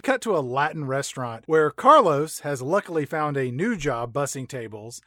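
ordinary delivery

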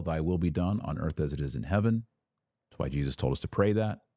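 ordinary delivery

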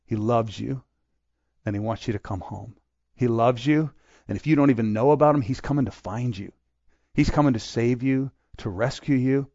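soft, hushed pastoral plea; tender appeal. Real, not acted. Preacher softly assuring the congregation of God's love. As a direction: gently invite them home.

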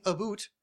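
"a boot" for about, with an oo vowel; mock Canadian vowel in "a boot". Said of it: "they don't say a boot".

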